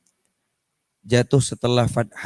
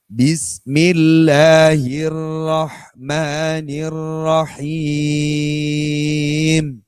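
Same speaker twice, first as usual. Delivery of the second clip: very slow, clear recitation, no melody; slow careful Quran reading for clarity; recitation without melody; 4 semitones higher, much slower than usual. Shown as a demonstration. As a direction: recite each word slowly and plainly. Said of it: "Tanpa lagu. Biar langkung jelas".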